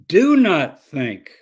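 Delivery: slow, loud, stressed "Do not think"; emphatic command. Shown as a demonstration. Teacher drilling a point for the class, slow and emphatic. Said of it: "I'm not asking you, I'm telling you".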